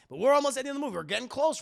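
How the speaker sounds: ordinary talk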